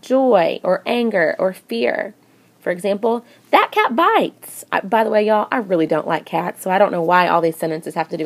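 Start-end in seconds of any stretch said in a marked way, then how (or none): 3.5-4.7 high, slow alarmed cry; scared high-pitched warning; fearful exclamation. Shown as a demonstration. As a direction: warn in fright that the cat bites. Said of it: "because I'm expressing a strong emotion, probably fear".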